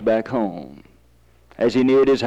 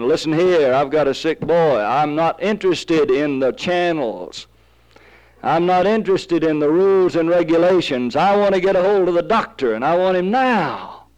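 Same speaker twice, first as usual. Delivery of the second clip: firm, mounting insistence, higher by the end; insistent demand; 4 semitones higher than usual. Acted. Father demanding a doctor for his sick boy, insistent. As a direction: press harder with each demand.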